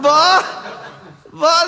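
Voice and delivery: loud, high dismissive scoff; scoffing kid. Acted. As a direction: wave off the other kid's boast, loudly. (niño burlón, agudo y fuerte)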